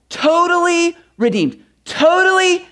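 "Totally redeemed" high and jubilant; exultant. Shown as a demonstration. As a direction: lift "Totally redeemed" up in triumph.

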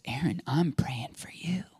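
soft whisper of the Holy Spirit; Holy Spirit's voice. Retold or imitated. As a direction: whisper it gently, like a voice from within. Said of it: "And the Holy Spirit goes".

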